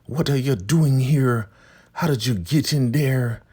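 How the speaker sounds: high, loud, scolding church elder; Elder Mother Marva. Retold or imitated. Recapper voicing Marva confronting Ruth, high and loud. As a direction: bark at her like an outraged elder matron. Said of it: "Elder Mother Marva walks up".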